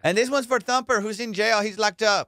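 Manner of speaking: put-on girl phoning the station to dedicate a song, high and loud.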